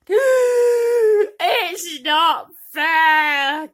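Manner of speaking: very slow, high, loud crying wail; gasping sob. Acted. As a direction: sob it out in despair. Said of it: "gasped Bertha".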